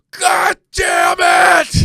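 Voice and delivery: high, loud, slow hammed-up yell; furious shout. Stepdad blows up when Gary finally says good morning, mock furious yell. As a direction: explode in mock fury, dragging it out. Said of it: "He goes".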